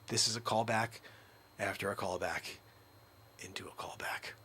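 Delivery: low, soft near-whisper; whispered comment on the running joke; hushed aside. Real, not acted. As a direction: murmur it like a secret.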